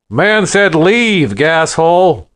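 loud, high, slow threat; angry threat. Acted. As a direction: spit it out slowly, ready to fight. The bartender backs Stan up.

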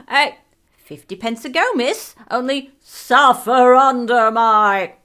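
voices breaking in, swelling to a loud cry; many tongues screaming. A narrator's reading. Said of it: "screamed in a hundred thousand tongues".

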